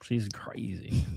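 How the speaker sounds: soft unvoiced aside; whisper. Real, not acted. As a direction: whisper it behind her back. Whispered aside about her.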